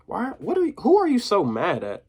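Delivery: high, pressing question. A narrator's reading. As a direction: push him for an answer. Diamond confronting Free.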